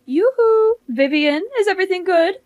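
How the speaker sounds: high, loud yoo-hoo greeting; calling out. Acted. Ex-mother-in-law hailing Vivian, high calling voice. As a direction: sing out to get her attention.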